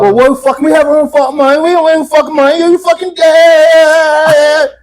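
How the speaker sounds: high, loud mock English girl outrage; hammed-up English rant, stretched out at the end; imitated English accent. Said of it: "'Cause you English motherfuckers".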